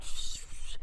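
unvoiced hushing shh; whispered shh. Shushing herself while typing super fast, whispered. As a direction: hush yourself with a whispered shh. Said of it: "and I'm like".

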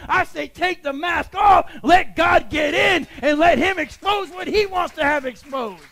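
loud, high preacher's shout; fiery sermon call; preaching at full force. Acted. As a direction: drive it home to the congregation. Preaching climax.

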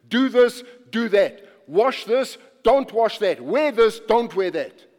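loud, driving sermon voice; rising fervent preaching, punching each phrase; preaching at full force. Acted. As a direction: preach it hard to the congregation.

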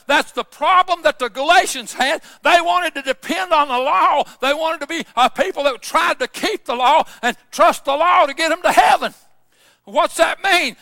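forceful pulpit delivery, pressing each point home; impassioned preaching. Real, not acted. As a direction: preach it out with rising conviction.

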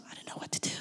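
whispered mock bewilderment; trailing into a barely audible whisper; hushed self-questioning. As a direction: drop to a whisper, acting lost. Acts out self-doubt, nearly voiceless.

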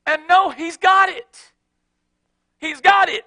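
loud, rising preacher's exhortation; preaching at full force. Real, not acted. Pastor urging the congregation to let go, full-force preaching. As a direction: drive it home to the congregation.

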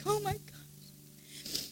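high, hushed tearful gasp. Retold or imitated. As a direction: whisper it through rising tears, drawn out. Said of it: "I started crying".

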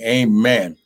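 normal talking voice

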